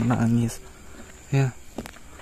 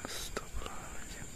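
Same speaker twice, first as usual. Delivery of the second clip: a hushed whispered prayer; 17 dB quieter than usual. Real, not acted. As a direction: whisper the prayer so the ghost won't hear. Ghost hunter near the kuntilanak.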